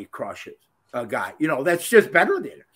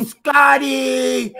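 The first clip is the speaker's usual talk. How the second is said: yelled name; 8 semitones higher, 4 dB louder than usual. Retold or imitated. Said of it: "I go".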